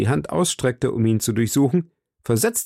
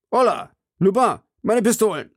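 calling out; 10 semitones higher than usual. Acted. Nobleman calls his servant.

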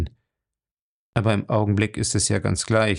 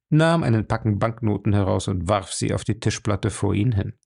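ordinary delivery

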